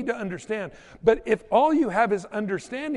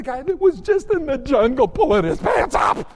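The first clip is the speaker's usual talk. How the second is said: higher and louder, shouting the punchline; laughing outrage at the reveal; excited shout; 6 semitones higher, 8 dB louder than usual. Real, not acted. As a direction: blurt it out in disbelief. Punchline of the jungle story.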